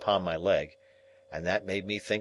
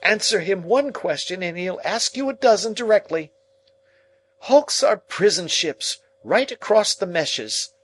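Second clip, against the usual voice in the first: much higher, louder scolding; scolding sister; 12 semitones higher, 6 dB louder than usual. Acted. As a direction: lecture him sharply.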